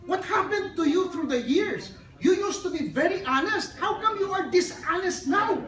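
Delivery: hammed-up angry angel scolding the woodcutter; mock angry angel. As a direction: scold him like a let-down angel, half laughing. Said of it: "Then the angel was so angry".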